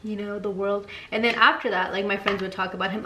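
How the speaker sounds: ordinary talk, nothing marked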